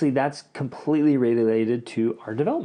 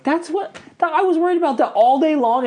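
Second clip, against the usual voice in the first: much higher and louder, strained cry; mock anguished outburst; 8 dB louder than usual. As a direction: burst out as if worried sick all day. Retelling dread of the talk.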